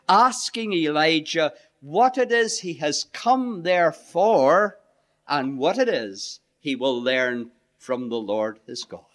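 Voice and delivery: normal talking voice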